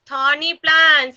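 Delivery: slow, drawn-out THORNY PLANT; teacher modelling. Shown as a demonstration. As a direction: stretch out "Thorny plant" for young children. Teacher models the answer for children.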